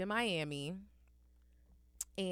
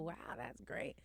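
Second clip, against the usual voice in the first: breathy whisper of fake enthusiasm; whispered aside; 4 semitones lower, 10 dB quieter, much slower than usual. Whispering to the NFL guy, a polite hushed wow. Quoting her own polite reply.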